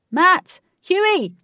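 high, very loud calls; shouting. A narrator's reading. Crowd calling out for the two missing men, shouting. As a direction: shout the names across the water. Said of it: "Many began to shout".

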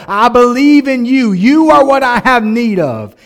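loud, high, building prayer of surrender; impassioned plea. Acted. Preacher voices a believer's plea.

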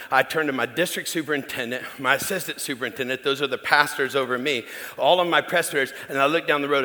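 ordinary talk, nothing marked

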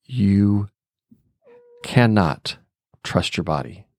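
retold grave warning, broken by pauses; dead serious. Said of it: "he's dead serious".

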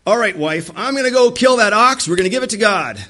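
husband eagerly telling his wife he will offer the ox. Retold or imitated. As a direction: play the eager husband announcing the ox. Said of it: "They're like".

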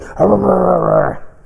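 high, rough mock growl of a snarling dog; imitated dog growl. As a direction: growl like the big dog baring its fangs. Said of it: "and he'll growl".

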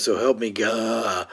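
drawn-out, struggling "God" that won't come out; choking on GOD. Acted. Vampire reciting an oath he can't say, choking on GOD. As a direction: strain slowly toward GOD and fail to finish it. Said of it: "he can't get the word God out".

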